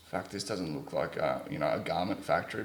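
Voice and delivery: low, soft thought to yourself; retold private doubt. Traveller seeing an industrial place, quietly doubtful.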